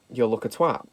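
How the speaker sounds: ordinary talk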